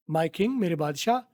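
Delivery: ordinary talk, nothing marked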